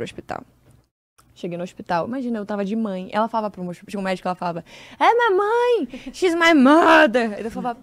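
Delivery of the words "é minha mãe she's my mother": high, loud, stretched-out mock wail; crying out. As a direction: wail it to a doctor like a patient in distress. Imitating friend crying out to doctor. (grito agudo e arrastado, imitando)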